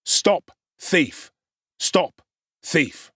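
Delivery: a crowd taking up the shout, mildly voiced; swelling shout. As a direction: lend vigour to the cry. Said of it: "swell the shout".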